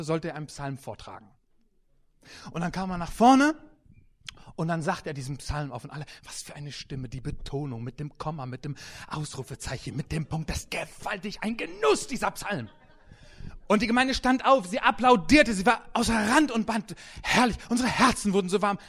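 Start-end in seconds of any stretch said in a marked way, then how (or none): 6.2-12.7 hushed, low awe rising on the praise; mock awed admiration. Congregation marvelling at a fine reciter, hushed mock awe. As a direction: start softly marvelling, lift into rapture.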